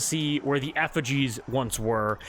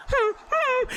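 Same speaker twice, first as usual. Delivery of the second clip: very high puzzled hums; confused humming. Acted. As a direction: hum in bewilderment. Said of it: "Temperance is like".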